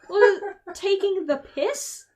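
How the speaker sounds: slow, loud, disbelieving question; loud, slow baffled repeat; incredulous. Real, not acted. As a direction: drag it out in disbelief. Baffled at British slang.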